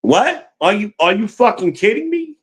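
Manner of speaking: high, outraged disbelief; you can't believe she just hung up on you; sharp high-pitched what-the-hell; incredulous outburst. Real, not acted. Reacting to caller hanging up.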